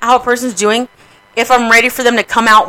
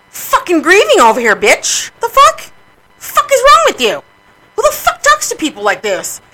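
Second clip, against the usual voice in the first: high-pitched, loud, indignant retold outburst; snapped; 10 semitones higher, 3 dB louder than usual. Griever telling off people who approached, snapped and indignant. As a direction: relive the blow-up, voice leaping up in outrage. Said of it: "I fucking snapped".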